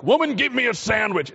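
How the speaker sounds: a hammed-up disrespectful order; mock rude demand. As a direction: bark it out like a rude chauvinist. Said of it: "that's not a derogatory".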